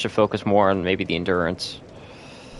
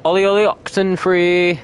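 high, singsong hide-and-seek call; playful calling out; 7 semitones higher, 4 dB louder than usual. Pretend. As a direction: call out for someone hiding. Calling out to missing friend Ghost.

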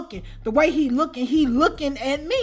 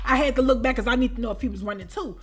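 usual speaking voice

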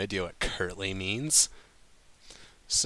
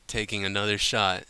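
usual speaking voice

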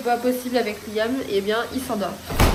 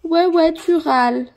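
higher, louder teasing coo; 6 semitones higher, 8 dB louder, slower than usual. Real, not acted. Mother teases her grumbling baby, high loud baby talk.

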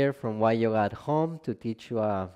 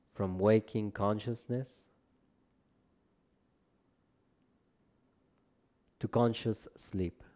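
calm, measured relaxation guidance, phrase by phrase; guided meditation; 3 dB quieter than usual. Acted. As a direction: guide them gently down into rest, unhurried and spacious. Leads a yoga nidra practice.

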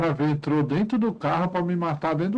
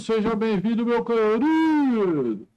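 hammed-up host welcome; 4 semitones higher, slower than usual. Greets a viewer like a host.

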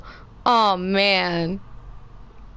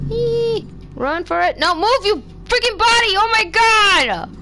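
frantic yell; 9 semitones higher than usual. Real, not acted. Fleeing monster.

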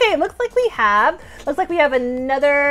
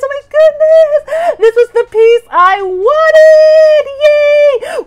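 high, loud, slow gush of joy; loud, high, drawn-out squeal; overjoyed; 11 semitones higher, 10 dB louder, slower than usual. Real, not acted. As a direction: near tears of delight. Found the wanted piece.

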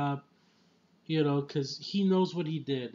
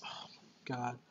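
breathy whispered oh my God; whispered awe; 3 semitones lower, 13 dB quieter than usual. Real, not acted. Reviewer stunned by the scene, whispered.

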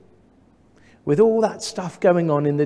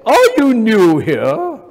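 put-on loud, high, drawn-out Cambridge accent; Cambridge-sounding voice; 7 semitones higher, 10 dB louder, slower than usual. Somebody greeting a newcomer at church, hammed-up Cambridge voice. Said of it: "in a very Cambridge-sounding voice".

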